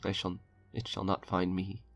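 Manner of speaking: ordinary delivery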